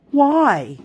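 mocking toddler why. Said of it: "It's like a, "I'm mocking you" kind of why".

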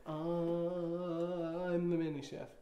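slow, drawn-out mock hesitant line; imitating hesitation. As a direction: stretch it out, teasing her doubt. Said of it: "where you were like".

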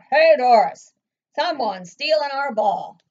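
loud, ringing storybook shout; boy calling out. A narrator's reading. Boy alerting Doris to a ball thief, loud calling out. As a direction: holler the alarm to a friend. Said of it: "a boy's voice rang out".

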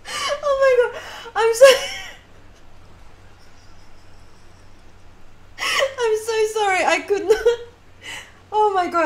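high, teary laughing squeals; crying with laughter. Real, not acted. Player can't stop laughing at the game, crying with laughter. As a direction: laugh until the tears come. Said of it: "literally crying, um, from all the laughter".

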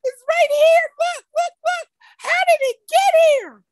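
put-on be the kid pointing and demanding answers; girl insisting to grandmother about the dead fish, high and frantic; upset little girl. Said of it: "And I said".

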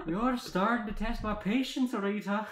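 high, loud, irritated character voice; Merida. A narrator's reading. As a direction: warn loudly with patience running out. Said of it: "said the ever-annoying Merida".

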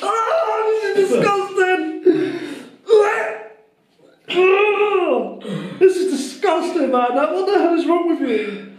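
high, laughing disgust. Real, not acted. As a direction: gag and laugh at the taste. Tasting the drink, disgusted.

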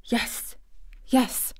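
hushed, solemn answer; almost whispered. A narrator's reading. Boy promises his solemn friend secrecy, almost whispering. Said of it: "he almost whispered his answer".

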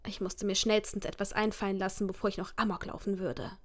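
ordinary talk, nothing marked

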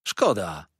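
mildly mournful waiter; a falling word of regret; saddened. A narrator's reading. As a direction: lament with a touch of sorrow. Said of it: "rzekł zasmucony".

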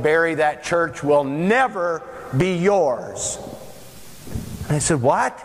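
ordinary talk, nothing marked

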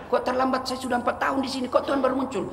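high, light questioning voice; puzzled maid. Acted. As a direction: ask the master in innocent surprise. Maid questions her late master.

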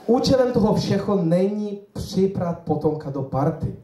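mock German accent in Czech; German lecturer character. German lecturer addresses a Czech audience, mock German accent. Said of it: "Já se vžiju do role".

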